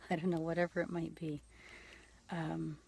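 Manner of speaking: usual speaking voice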